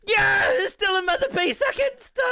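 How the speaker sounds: high, loud cheer; celebratory shout. Real, not acted. Commentator celebrating a second place, high excited shout. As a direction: burst out cheering, voice right up high.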